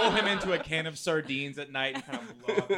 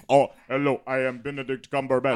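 low, loud mock actor with a bad accent; badly faked accent, hammed up; Benedict Cumberbatch; 4 semitones lower, 8 dB louder than usual. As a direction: introduce yourself grandly in a put-on accent. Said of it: "American accent not so good".

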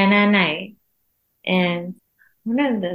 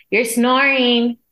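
higher, drawn-out "snoring"; retold exasperated wake-up call, slow and raised; insistent complaint; 4 semitones higher, 4 dB louder, slower than usual. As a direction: stretch out "snoring" to nudge a sleeper awake. Repeats louder to waking snoring husband.

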